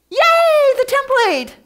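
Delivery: high, loud, hammed-up cheer; mock cheer. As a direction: cheer for a paper template, ironic. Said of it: "this is where you squeal".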